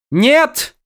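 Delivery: high, loud cry of protest; shout. Acted. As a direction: scream it out in alarm. Вин в ужасе кричит. (громкий, высокий крик)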